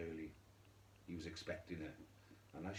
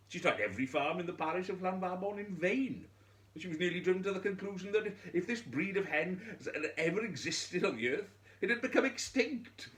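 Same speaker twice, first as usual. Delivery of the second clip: much higher and louder, building in frustration; mounting exasperation; 12 semitones higher, 8 dB louder than usual. A narrator's reading. Narrator to listeners about the widow's fruitless hunt, rising exasperation. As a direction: let the desperation of the endless search rise. Narrating frantic weary search.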